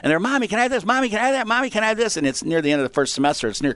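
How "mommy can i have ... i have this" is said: high, insistent mock little-kid whine; begging kids. Kids begging their mom for checkout toys, imitated pleading. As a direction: pester Mommy in a high, repeated sing-song plea.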